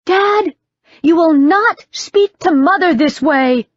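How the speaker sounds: high, loud protest at her father; shrill-free cry of outrage, pitched high and loud; indignant outburst. Acted. Daughter scolding her father at dinner, high and loud.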